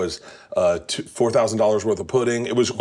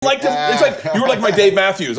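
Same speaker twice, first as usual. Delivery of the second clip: excited gushing; 14 semitones higher, 4 dB louder than usual. Retold or imitated. Gushing about fandom.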